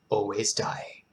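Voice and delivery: low, near-whispered final words; hushed poem ending. Acted. Host closing a grim poem for listeners, hushed. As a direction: let the last words sink almost to a whisper.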